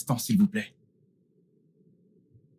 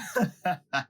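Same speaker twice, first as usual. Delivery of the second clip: laugh; 6 semitones higher than usual. Acted. Laughs again.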